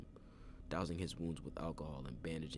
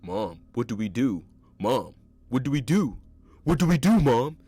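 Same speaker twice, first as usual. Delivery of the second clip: shrieking, much higher and louder; screaming in panic; 8 semitones higher, 20 dB louder than usual. A narrator's reading. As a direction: scream it at the top of your lungs. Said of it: "he screamed at the top of his lungs".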